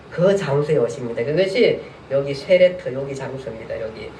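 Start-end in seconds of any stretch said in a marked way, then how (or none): none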